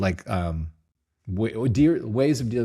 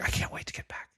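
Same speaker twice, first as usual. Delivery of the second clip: breathy sarcastic whisper, much softer; mock whisper; 8 dB quieter than usual. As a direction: whisper it as if faking enthusiasm. Mock-enthusiastic quote after yoga.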